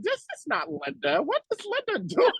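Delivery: high, laughing disbelief; high amazed what is she doing. Retold or imitated. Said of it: "I'm like".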